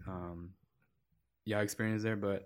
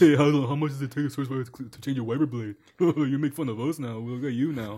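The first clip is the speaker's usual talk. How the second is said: Kubo; 5 semitones higher, 7 dB louder than usual. Retold or imitated. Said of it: "Kubo was like".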